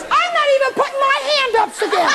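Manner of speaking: very high, squeaky refusal; sulky little voice. Acted. Speaker voices students' little voice after second trick, squeaky mock sulk. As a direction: whine it high like a petulant inner voice. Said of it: "Now the little voice says".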